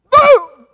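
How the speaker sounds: explosive, high shouted boom; one very loud imitated blast; sudden boom. Shown as a demonstration. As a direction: burst out to startle the listeners.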